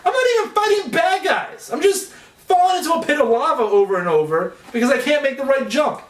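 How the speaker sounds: high-pitched indignant rant; hammed-up frustrated retort; exasperated gamer. Acted exasperated retort to the game.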